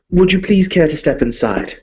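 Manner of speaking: refined vampire lord greeting a guest; Viscount Alexander Harkin. Acted. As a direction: play a polite, fussy nobleman. Viscount speaking in character.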